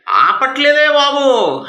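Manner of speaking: high, slow, loud delighted cry. Acted. God boasting to the Pharisee about the woman, high and delighted. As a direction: stretch it out in pure delight.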